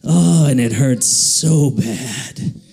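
low, slow comic moan of pain; drawn-out mock agony; foot's noise. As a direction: groan it out slowly as an injured foot. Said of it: "It went a little something like".

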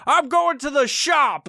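slow, high mock huff of an angry dad; hammed-up angry dad announcement; drunk dad quote. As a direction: sulk off to the workshop, overplayed. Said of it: "And he's like".

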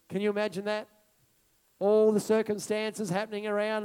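ordinary talk

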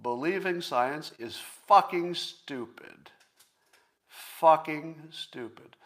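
slow, heavy emphasis on fucking stupid; slow contemptuous punch on fucking stupid; emphatic cursing. Real, not acted. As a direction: hammer each word down slowly. Ranting at believers.

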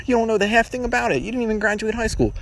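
high scornful mock voice; high mock sneer; mocking commenter. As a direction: jeer at him like a smug student. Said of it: "type in the comments".

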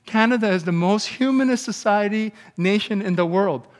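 usual speaking voice

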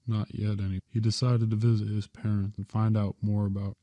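plain reading voice

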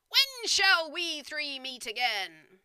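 high, loud, drawn-out witch shriek; eldritch shriek. Acted. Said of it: "an eldritch voice shrieked".

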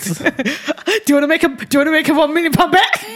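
high, loud laughing dare; real excited, giggly dare to her husband; excited challenge. Real, not acted. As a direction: shout the bet through laughter.

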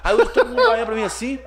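can't stop laughing as he tells it. Real, not acted. Telling a friend about the crowd, laughing.

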